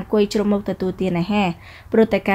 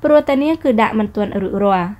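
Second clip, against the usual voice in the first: plain reading voice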